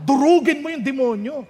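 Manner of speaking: normal talking voice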